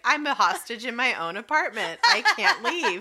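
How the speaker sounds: high-pitched exasperated plea through laughter; mock desperation. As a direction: imitate someone comically trapped at home. Trapped trainer's complaint acted out.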